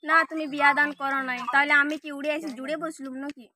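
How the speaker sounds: ordinary talk, nothing marked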